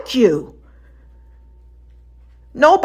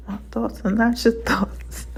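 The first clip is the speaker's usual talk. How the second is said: breathy whispered line; 9 dB quieter than usual. Retold or imitated. Repeating God's words to herself in a whisper.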